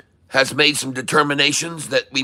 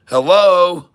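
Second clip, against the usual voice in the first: emphatic hello; 5 semitones higher, 5 dB louder than usual. Real, not acted. Rhetorical call to viewers.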